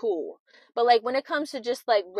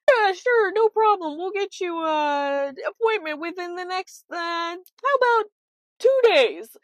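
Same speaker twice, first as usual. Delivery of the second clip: 1 imitating receptionist; 8 semitones higher than usual. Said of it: "let's say, Monday when I noticed".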